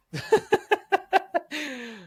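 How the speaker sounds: long, high-pitched laugh; amused laughter. Real, not acted. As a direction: crack up at the shared joke. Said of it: "I just thought it was funny".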